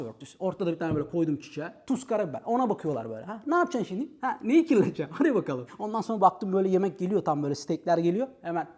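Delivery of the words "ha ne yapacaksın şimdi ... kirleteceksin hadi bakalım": mocking challenge, a bit louder; smug taunt at the customers. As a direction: dare them to dirty anything. Voicing himself taunting customers.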